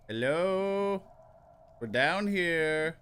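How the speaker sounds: high, loud call up out of the well. Acted. Stuck at the bottom of the well, calling up loud and high. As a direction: shout up for help, slowly.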